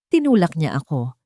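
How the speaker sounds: usual speaking voice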